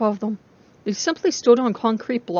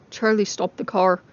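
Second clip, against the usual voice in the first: ordinary delivery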